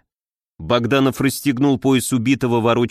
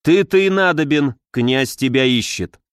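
usual speaking voice

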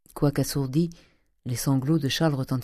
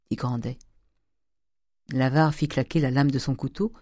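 plain reading voice

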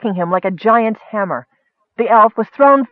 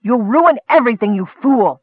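higher-pitched, angry rebuke; moderately raised elf's yell; 5 semitones higher, 3 dB louder than usual. A narrator's reading. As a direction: yell at a fool in desperation. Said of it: "the elf yelled".

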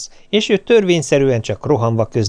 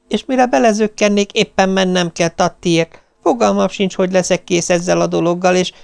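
higher, harried stream of excuses; nervous complaint; 7 semitones higher than usual. Retold or imitated. Wife explains to husband on phone, higher and harried. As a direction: pour out the excuses, frazzled.

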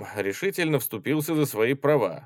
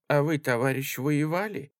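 higher-pitched ingratiating question; obsequious; 6 semitones higher than usual. A narrator's reading. Little old woman asks tall guardsman about fighting, obsequiously. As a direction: ask sweetly, eager to please. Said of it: "обращаясь угодливо к молодому рослому солдату".

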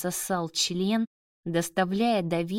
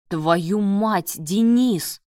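louder, exasperated outburst; angry cursing; 6 dB louder, much slower than usual. A narrator's reading. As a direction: curse at your friend, hitting his name hard. Appalled friend curses at surgeon. (гневное ругательство, громко)

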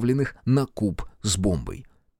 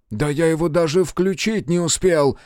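higher-pitched indignant comeback; barked retort; 6 semitones higher than usual. Acted. Engineer answers the crewman's order, barked retort. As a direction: bark back in protest. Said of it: "рявкнул в ответ инженер".

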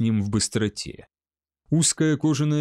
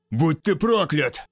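slow, emphatic curse; cursing; 2 semitones higher than usual. A narrator's reading. Wounded barbarian at his opponent, cursing. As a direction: spit out a curse, drawn out. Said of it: "выругался он".

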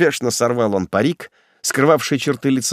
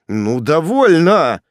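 slow, higher, drawn-out command; cutting him off; 4 semitones higher, 4 dB louder, slower than usual. A narrator's reading. Executioner stops the grovelling prisoner, firm command. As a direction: put a stop to the pleading. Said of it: "остановил его палач".

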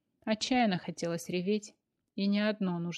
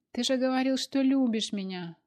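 sobbing; 6 semitones higher, 5 dB louder than usual. A narrator's reading. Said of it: "всхлипнула Машка".

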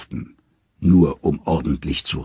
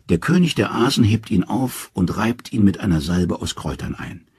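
ordinary delivery

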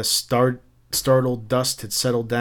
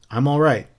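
ordinary delivery

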